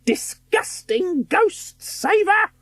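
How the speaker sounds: high, slow, drawn-out witch voice; eerie hunter call. A narrator's reading. Ghost hunter calling up the stairs, eerie and slow.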